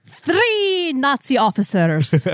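high, slow vampire counting. Pretend. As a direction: count triumphantly like a puppet vampire. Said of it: "it inevitably turns into the Count".

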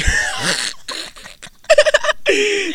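long, very high, loud laugh; high cackle. Real, not acted. Cohost cracking up at the teasing, high loud cackle.

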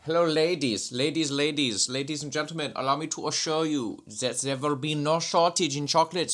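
put-on reassuring press-statement voice, comic German accent. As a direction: address the crowd in a hammy German accent. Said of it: "we're, we're in Germany, pal".

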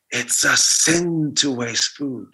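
very slow, hushed echo of grandma; haunting inner voice. Retold or imitated. Grandmother's voice echoing in his head, very slow and hushed. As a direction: let it creep in like a memory.

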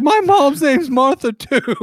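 very high, giggling line; can't hold back the laugh; squeaky mock voice breaking into laughter; laughing through it. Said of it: "He's like".

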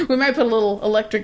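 fast, through laughter; laughing. Real, not acted. Jokes to co-host about shocking pranksters, laughing. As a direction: crack up at the idea. Joking threat while laughing.

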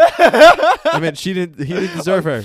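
high, loud laughing correction; loud laughing slip fixed between friends. Real, not acted. Joking correction amid laughter.

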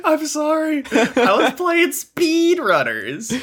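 very high whiny plea, stretched at the end; mock wailing apology. Podcaster excusing his late-night screams, in a high mock wail. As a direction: wail a laughing, hammed-up excuse.

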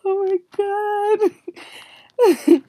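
high, laughing exclamation; helpless real amusement; through laughter. Real, not acted. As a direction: gasp it out while cracking up. Still laughing at own joke.

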